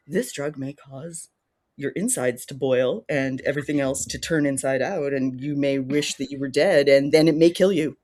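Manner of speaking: low, measured mock commercial announcer; drug ad disclaimer. Podcaster parodying US drug ads, low announcer voice. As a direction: read grim side effects like an ad voice-over. Mimicking a drug ad's side-effect warning.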